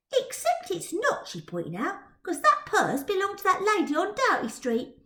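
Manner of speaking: put-on Twill's prim girl voice. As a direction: correct him primly.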